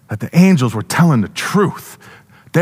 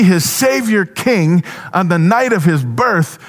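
rising higher and higher as the truth dawns; growing excitement; 6 semitones higher than usual. Acted. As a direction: build from a slow start to an awed, high climax. Shepherd realising the sign, building awe.

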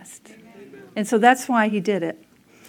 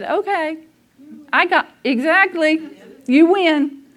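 raised, higher exasperated giving up; mock surrender; 6 semitones higher, 3 dB louder than usual. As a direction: throw up your hands and concede to God. Retold surrender to God after Monday.

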